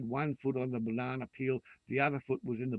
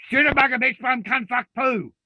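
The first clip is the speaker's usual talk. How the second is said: much higher, much louder yelled swearing; swearing outburst; 11 semitones higher, 13 dB louder than usual. Real, not acted. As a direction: explode into a torrent of curses. Bursts into a string of swearwords.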